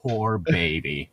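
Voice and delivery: teasing a sulky vampire, slow mock-pitying coo. Mock pity for Edward.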